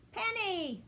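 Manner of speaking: high, lonely little rabbit voice calling. A narrator's reading. Betsy the rabbit calls to Penny, high and lonely. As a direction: call her over in a high sad voice. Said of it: "she cried".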